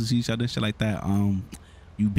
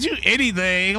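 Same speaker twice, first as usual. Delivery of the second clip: high, drawn-out mocking imitation; mimicking her odd talk; 7 semitones higher, 3 dB louder, slower than usual. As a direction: stretch it out high, mocking how she talks. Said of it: "Why she sucking like that?".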